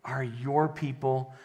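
low, soft praying voice; prayer. Real, not acted. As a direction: pray quietly and unhurriedly to God. Opens with a prayer.

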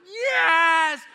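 put-on loud, high, drawn-out cheer of excited kids; imitated children whooping with joy; erupting in enthusiasm. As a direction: shout it like kids thrilled by a surprise. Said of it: "they just erupted in enthusiasm".

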